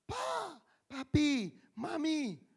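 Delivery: slow, high mock wail of a hurt child; child crying out. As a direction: call for your parents like a hurting kid. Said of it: "pasti teriak papanya". (anak kesakitan memanggil papa mama, agak dibuat-buat)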